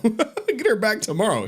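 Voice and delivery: ordinary talk, nothing marked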